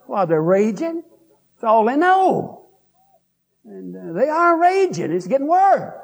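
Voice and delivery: high, rising pulpit cry; fervent preaching. Acted. Preacher warns the congregation about raging, rising fervently. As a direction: lift it up and drive it home.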